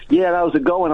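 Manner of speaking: imitating himself slipping into American; crazy American accent. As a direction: greet them in a fake American accent. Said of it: "doing this crazy accent".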